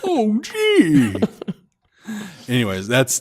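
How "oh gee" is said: put-on high old man exclamation. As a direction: gasp it like a grandpa. One more old man line.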